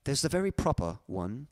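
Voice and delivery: very proper, elegant English accent; proper British accent. Shown as a demonstration. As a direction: put on a crisp British accent with pronounced T's. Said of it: "I'll do my best impression".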